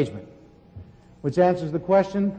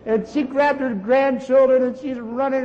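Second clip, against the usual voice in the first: loud, slow, stretched exclamation; drawn out in delight; excited; 6 semitones higher, 6 dB louder, much slower than usual. Real, not acted. Preacher relishing how the healed woman danced, slow excited exclaim.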